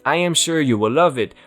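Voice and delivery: plain reading voice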